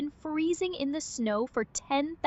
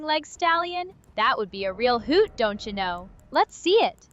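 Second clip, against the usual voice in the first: put-on folksy Wisconsin accent with sing-song lilt. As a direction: play it as a chipper Wisconsin local. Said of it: "That's your new accent".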